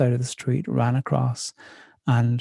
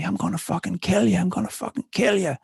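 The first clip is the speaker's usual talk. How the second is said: mock threatening yell; 7 semitones higher than usual. Aggressive man screaming threats.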